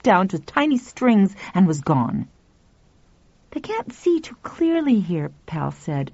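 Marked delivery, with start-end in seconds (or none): 3.5-5.3 put-on calm boy voice.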